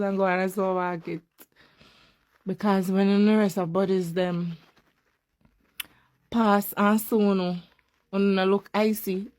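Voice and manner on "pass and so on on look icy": ordinary talk, nothing marked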